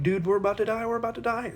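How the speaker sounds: high, frantic, a bit hammed up; mock panic. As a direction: pitch it up like panicky fishermen. Panicking disciples wake Jesus.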